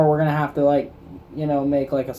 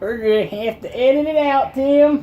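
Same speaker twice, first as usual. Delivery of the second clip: put-on Southern accent; 8 semitones higher than usual. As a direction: lay on the Southern twang. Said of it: "That voice".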